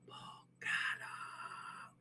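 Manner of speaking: a slow, voiceless teasing whisper; mock whisper. Teacher answering the imagined student, whispered. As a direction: breathe it slowly as a sly aside.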